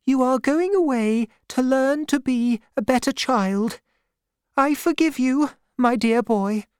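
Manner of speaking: high-pitched, measured woman's voice; Davy's mother, forgiving. A narrator's reading. Mother forgives Davy as he leaves for school, solemnly.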